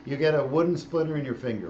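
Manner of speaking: usual speaking voice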